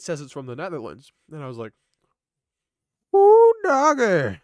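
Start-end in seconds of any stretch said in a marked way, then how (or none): 3.1-4.4 loud, high drawn-out whoop; excited whoop. Retold or imitated. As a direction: whoop it out long and high, falling at the end. Reacting to surprise package's arrival.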